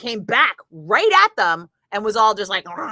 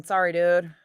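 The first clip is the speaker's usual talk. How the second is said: usual speaking voice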